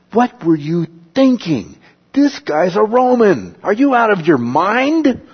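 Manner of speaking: panicked centurion. Retold or imitated. Centurion to the commander, frantic high disbelief. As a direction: shout it in horrified alarm. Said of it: "this is a panic".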